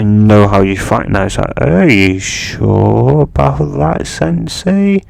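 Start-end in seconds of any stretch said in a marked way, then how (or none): 1.6-5.0 slow, drawn-out cocky taunt; lightly voiced smug student. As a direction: needle the teacher, savouring it. Naruto taunting his sensei.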